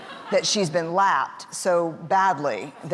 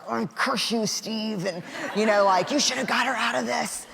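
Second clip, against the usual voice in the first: higher-pitched mock grievance at a spouse; mock cursing; 5 semitones higher than usual. Wife privately blaming her husband Steve, in mock resentment. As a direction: curse him in a high, half-joking undertone. Muttering at husband after agreeing.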